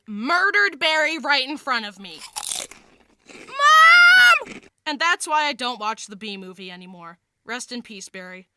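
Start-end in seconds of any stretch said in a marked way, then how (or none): 3.3-4.6 very high, drawn-out yell; horrified scream. Acted. Child calling mother after the dog killed the hamster, screaming. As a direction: scream for help at full volume.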